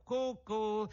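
high, sing-song taunt; high teasing call; mocking. A narrator's reading. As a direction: taunt them with a cuckoo call. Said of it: "in segno di canzonatura".

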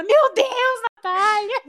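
high, horrified exclamation; scandalised grandma. Retold or imitated. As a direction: gasp out the granddaughter's name in horror.

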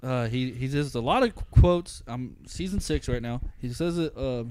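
normal talking voice

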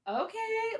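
very high, drawn-out sarcastic okay; a disbelieving squeak of okay; mock okay. Host to guest retrieving his vodka, high sarcastic okay. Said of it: "And I was like".

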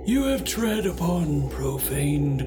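slow, eerie ghost proclaiming doom; unearthly voice. Acted. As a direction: intone it like a vengeful spirit. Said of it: "you hear a ghostly voice cry out".

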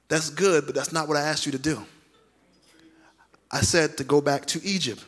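low, restrained reply with quiet authority; God correcting Moses. Acted. God reminding Moses of the order, quietly firm. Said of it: "God saying".

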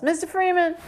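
put-on high-pitched girl's call. A narrator's reading. Girl summoning Mister Freeman from outside, calling out high. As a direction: call for him from out on the street. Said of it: "a girl's voice calls from outside".

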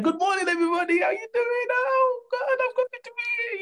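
over-the-top chirpy hello; overexcited cheery greeting. Pretend. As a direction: burst in beaming at everyone. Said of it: "you're like".

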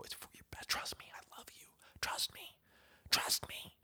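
put-on soft soothing whisper to a child; breathy comforting whisper to a toddler. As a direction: hush him like you're coaxing a toddler.

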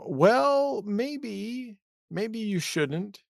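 a timid divine voice talking them out of it; soft high tentative god; hesitant god voice. Pretend. Imitating God's voice hint.

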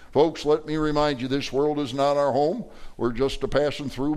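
normal talking voice

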